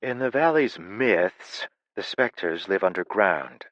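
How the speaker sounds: slow, drawn-out myths; stress on myths. A narrator's reading. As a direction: lean hard on the word myths. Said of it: "special emphasis on the word myth".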